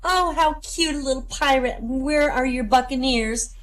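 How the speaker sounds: high, slowing lady's coo. Acted. Lady greeting a young trick-or-treater, high-pitched cooing. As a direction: coo over a little child in a high voice. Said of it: "the door is opened by a lady".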